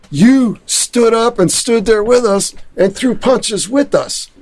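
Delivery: high, eager, childlike gushing, retold; gushing like children. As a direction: mimic a crowd of adoring kids, lightly. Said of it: "they're all around me like children".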